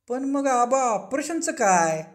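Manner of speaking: high, slow, drawn-out query; insistent question. A narrator's reading. Guruji and Chaulga press Aba about the operation, insistent. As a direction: stretch the question out, pitched high.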